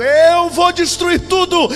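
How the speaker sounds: high, drawn-out roar of threat; loud shout. Acted. Preacher voicing the storm that threatens destruction, shouting loud. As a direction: bellow it like a raging storm. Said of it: "A voz da tempestade grita alto".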